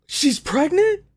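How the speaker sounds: high, stunned disbelief; can't believe what you just saw; shocked question. Real, not acted. Viewer learns the daughter is pregnant, stunned high question.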